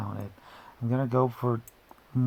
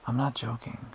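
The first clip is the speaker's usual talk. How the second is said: ordinary delivery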